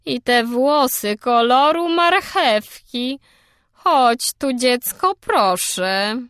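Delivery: put-on high, loud, prying old gossip; tactless busybody. A narrator's reading. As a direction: peer and pass judgment slowly. Mrs Lynde voiced, tactless.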